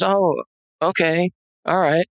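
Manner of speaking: high, slow, dazed agreement. Retold or imitated. Sedated wife agreeing to go for ice cream, out of it. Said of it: "she's just out of it".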